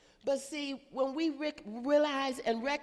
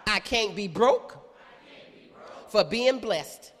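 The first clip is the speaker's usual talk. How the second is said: fervent preaching; 2 semitones lower, 8 dB louder, slower than usual. Real, not acted. Preacher at full force.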